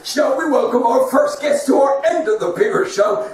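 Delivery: bright show host introducing the next act; compère intro. Acted. Host introducing the act to the audience, upbeat showman. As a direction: warm up the pier crowd like an emcee.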